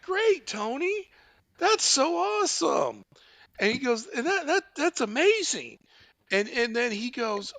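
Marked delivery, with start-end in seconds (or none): 0.0-5.8 very high, mock-delighted voice; gushing phone volunteer. Phone volunteer thanking fake donor Tony, gushing. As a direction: imitate an overjoyed volunteer up high.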